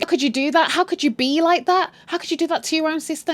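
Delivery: high-pitched indignant scolding; mock arguing. Essie argues with Sarah back home, mock indignant scolding. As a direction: act out a reproachful mother. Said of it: "and they're arguing".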